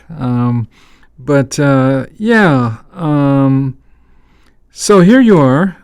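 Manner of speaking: ordinary talk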